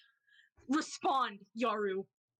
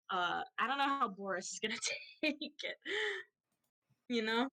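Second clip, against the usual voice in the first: ordinary delivery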